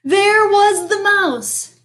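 high, drawn-out dramatic announcement; exasperated reveal. A narrator's reading. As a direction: raise the pitch and stretch the shock. Exasperating reveal, later repeated wearily.

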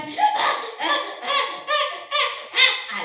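high loud donkey hee-haw; donkey braying with joy. Acted. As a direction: bray like a happy donkey. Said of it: "empezó a rebuznar".

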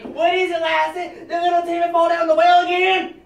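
high loud melodramatic TV parent; Lassie TV drama voice. Retold or imitated. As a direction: ham it up like old TV. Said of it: "I started to say".

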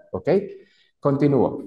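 normal talking voice

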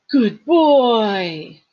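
loud, high, drawn-out praise; dog praise. A narrator's reading. Girl rewarding the three-headed dog, loud drawn-out praise.

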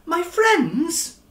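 high, loud excited question; cried. Acted. Stanley hearing of his friends, high excited cry. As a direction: jump up with hope. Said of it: "cried Stanley".

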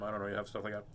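usual speaking voice

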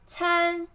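参 high and level; flat tone. Shown as a demonstration. Teacher breaking down attend for learners, level tone on 参. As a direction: hold 参 on one high, steady pitch.

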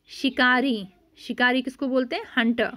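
ordinary delivery

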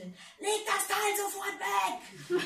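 high, slow shouted command; roaring order. Acted. Man yells at his wife on the phone. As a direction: bellow it down the phone at her. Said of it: "brüllte ich los".

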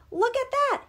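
very high, loud burst of wonder; excited exclamation. A narrator's reading. Olaf spots a baby reindeer, excited. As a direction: point it out eagerly, pitched high.

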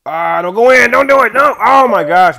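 loud, high drawn-out yell; panicked pleading. Real, not acted. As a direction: shout in real dismay at the shot. Pleading with the opponent's ball.

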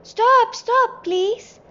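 very high, loud plea; full-voiced, high-pitched pleading shout; urgent shout. A narrator's reading. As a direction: shout desperately for it to stop. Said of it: "both shouted at the train's engine".

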